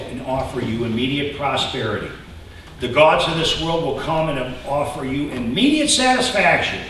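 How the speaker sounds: ordinary talk, nothing marked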